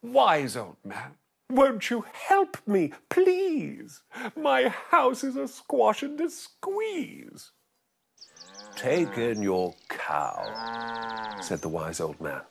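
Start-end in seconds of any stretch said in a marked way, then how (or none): 0.0-7.5 put-on high, pleading old woman; old lady voice. 8.8-11.4 put-on wise old sage.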